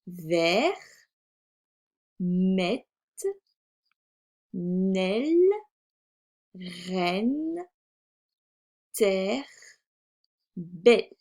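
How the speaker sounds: isolated, drawn-out syllables, mostly rising at the end; reading syllables aloud. Shown as a demonstration. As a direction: model each syllable for young readers. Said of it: "commencer par lire les syllabes". (lecture de syllabes, lente et détachée)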